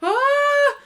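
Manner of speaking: high, loud shocked "Ah"; startled high cry; stunned gasp. Retold or imitated. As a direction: caught completely off guard. Stunned reaction to news.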